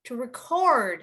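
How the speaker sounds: "record" stressed re-CORD; quick "re", strong CORD in "record"; stress on second syllable. Shown as a demonstration. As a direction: model the verb "record", weight on the end. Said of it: "if it's a verb, then you would say".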